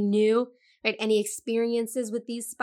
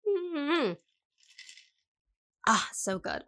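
sipping coffee; mid-sip, savoring; while sipping a drink; 6 semitones higher, much slower than usual. Real, not acted. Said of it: "Hold on, I'm gonna take a sip of coffee".

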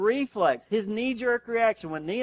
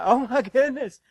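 alarmed exclamation; 7 semitones higher, 6 dB louder than usual. Retold or imitated. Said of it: "I'm like".

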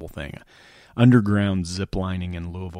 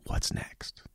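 whispered question, barely voiced; 8 dB quieter than usual. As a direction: whisper it like an inner nudge. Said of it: "constantly whispering to you".

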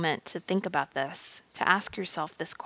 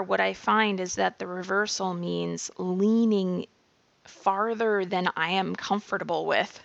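ordinary delivery

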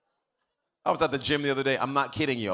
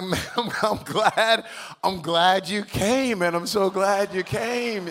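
high, slow, drawn-out gladness; re-enacted gushing welcome; effusive retold welcome; 6 semitones higher, slower than usual. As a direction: stretch the words out high, welcoming him effusively. Retold delighted, slightly awkward welcome.

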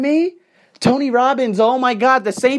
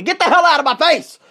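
higher-pitched, heated dismissal; heated send-off in a rant; angry dismissal; 6 semitones higher than usual. Real, not acted. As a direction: tell them off, pitched up. Rant at imagined critics.

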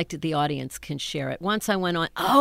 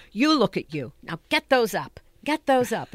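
higher, firmer mother's voice; acted stage mother; 5 semitones higher, 4 dB louder than usual. Mother to daughter at the mirror, lightly acted fussing. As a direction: fuss over your daughter with brisk commands.

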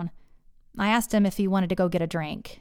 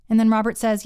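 usual speaking voice

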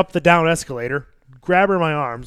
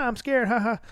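mock scared child; 8 semitones higher than usual. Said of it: "like, terrified".

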